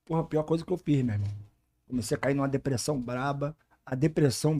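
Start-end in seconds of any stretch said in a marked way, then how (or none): none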